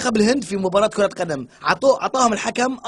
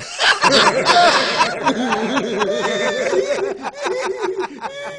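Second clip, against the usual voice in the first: laughter; 8 semitones higher than usual. Real, not acted. Laughing at joke.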